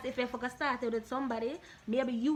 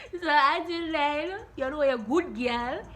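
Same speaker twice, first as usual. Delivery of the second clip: loud slow sign-off; 5 semitones higher, 8 dB louder, much slower than usual. Real, not acted.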